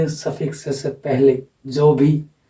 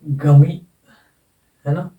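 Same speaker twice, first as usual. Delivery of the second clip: ordinary talk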